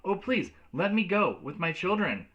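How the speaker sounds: high desperate plea; pleading cry. Acted. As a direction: beg to go with them. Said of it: "Miss Becker cried".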